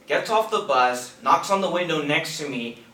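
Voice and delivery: ordinary talk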